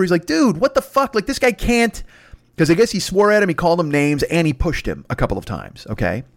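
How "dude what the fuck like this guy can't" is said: high-pitched appeal for help; acted outraged protest to officials; indignant complaint. Player calling the refs over, indignant complaint. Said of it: "he starts calling the refs over".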